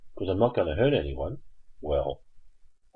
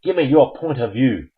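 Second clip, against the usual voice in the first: higher-pitched, insistent urging; rally your side to speak up; spirited appeal to the team; 6 semitones higher, 5 dB louder than usual. Real, not acted.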